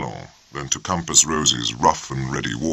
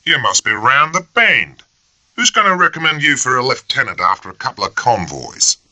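loud mocking sneer; first lieutenant scoffing at the application, loud; sarcastic scorn; 9 semitones higher, 4 dB louder than usual. A narrator's reading. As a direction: ridicule the request. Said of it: "a barrage of scorn and sarcasm".

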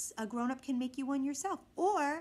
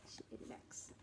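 soft unvoiced aside; whisper; 20 dB quieter than usual. Real, not acted. Mother whispering an aside to her child. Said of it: "unvoiced whisper".

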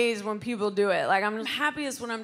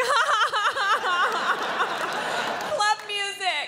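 forced fake laugh; 10 semitones higher, slower than usual. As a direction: fake a cheery laugh for the stallmate. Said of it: "You're like".

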